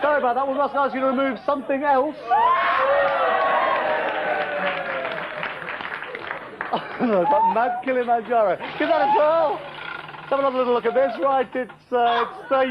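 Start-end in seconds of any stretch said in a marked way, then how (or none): 2.2-7.0 very high drawn-out silly laugh; long falsetto giggle; falsetto cackle. Acted. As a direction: let out a long squeaky cackle. High silly laugh after quiz.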